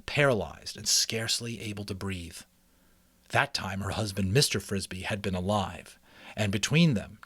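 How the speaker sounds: steady, lively read-aloud narration; story narration. A narrator's reading. Reading a mouse adventure to listeners, storytelling voice. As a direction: read it like a chapter book to listeners. Reads chapter book aloud.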